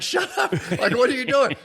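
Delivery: high, exasperated snap; annoyed teammate. Retold or imitated. Lineman telling his quarterback to stop, high and exasperated. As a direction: shoot back high and fed up. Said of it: "he's like".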